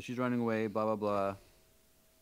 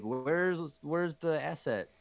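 raised pitch, slow puzzled question; imitating show character; 6 semitones higher, slower than usual. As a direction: act out the character's line, higher and drawn out. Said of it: "he turns on Toto and he's like".

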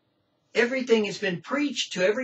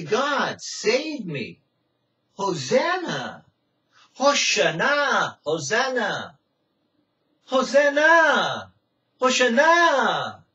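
slow, louder, higher pleading Hosanna; crying out; 4 semitones higher, 5 dB louder, much slower than usual. Retold or imitated. Preacher crying to God to save him, slow and loud. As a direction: stretch each Hosanna as a desperate cry. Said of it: "I cry out and I say".

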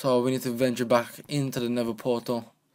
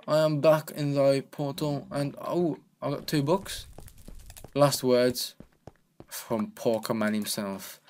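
ordinary talk

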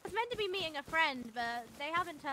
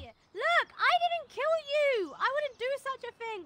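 anguished denial; 8 semitones higher, 7 dB louder than usual. Real, not acted. Defending against being blamed.

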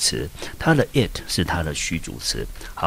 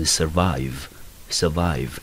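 "survive" slowly, stressing "vive"; stress on second syllable; much slower than usual. Shown as a demonstration. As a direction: model "survive" twice, slow, weight on the second syllable. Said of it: "重音节落在第二个音节". (慢速示范 "survive"，重音在第二个音节)

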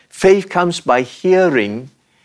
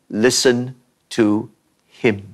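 "Listen ... to ... him", lower, broken by pauses; solemn spaced refrain; 4 semitones lower than usual. Acted. Preacher quoting the voice after "we have lost so much", solemn and spaced. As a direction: set "him" apart after a pause, dropping low.

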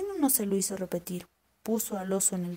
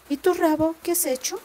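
voz de zorra aguda y viva, más alta; zorra astuta; 8 semitones higher, 6 dB louder than usual. Acted. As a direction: una zorra lista que pregunta con curiosidad. (la zorra pregunta al campesino asustado, voz aguda y curiosa)

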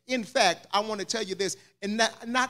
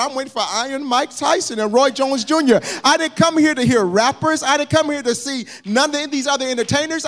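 rising, louder preacher's rant; mock frustrated rant; 7 semitones higher, 5 dB louder than usual. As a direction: build it up like a fed-up fan. Preacher acting out his frustration.

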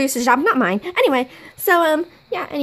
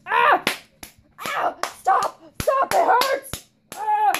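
pained cries; 6 semitones higher, slower than usual. Acted. Being beaten, crying out.

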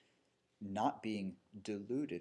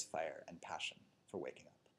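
intense whisper; 5 semitones lower, 7 dB quieter than usual. Acted. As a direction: whisper the fire and passion. Stressing passion for awakening.

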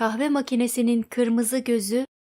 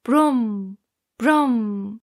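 mock clank. A narrator's reading. Iron sound effect repeated.

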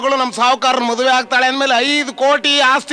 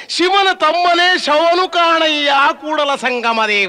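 slow, chanted like a Basavanna vachana; mock vachana recitation; 4 semitones higher, slower than usual. Servant reading the palm leaf to the landlord, slow mock-sacred chant. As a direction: intone it slowly like sacred verse. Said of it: "Mahatma ಬಸವಣ್ಣವರೇ ಬರ್ದಿರ್ಬೇಕು ಇದನ್ನ".

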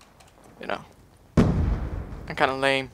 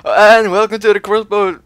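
loud intro shout; 6 semitones higher, 10 dB louder than usual. Real, not acted. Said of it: "I just can't do my intro anymore".

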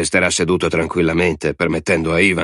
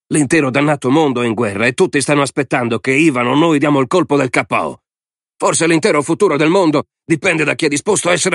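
higher-pitched military adviser pressing his case; insistent, raised-pitch exhortation; urgent argument; 5 semitones higher than usual. A narrator's reading. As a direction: argue heatedly for action. Adviser urging the president to act.

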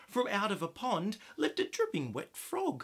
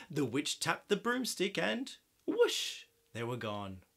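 ordinary delivery

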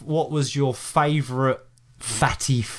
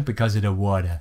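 much lower mock Brooklyn accent; imitated Brooklyn local, pitched right down; 8 semitones lower than usual. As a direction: do the Brooklyn guide as a deep-voiced punchline. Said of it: "She had the proper accent".

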